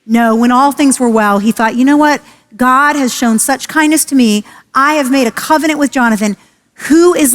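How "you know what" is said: ordinary delivery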